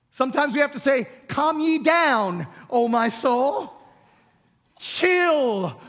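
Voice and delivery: loud, rising calls to his own soul, oh my soul drawn out slowly; rousing self-exhortation. Acted. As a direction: preach it to your own soul with full force. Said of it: "that's what he does".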